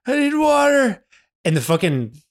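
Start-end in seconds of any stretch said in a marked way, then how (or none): 0.1-1.1 high, drawn-out dying wail; mock crying. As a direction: whine for help like a wounded man. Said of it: "like, crying".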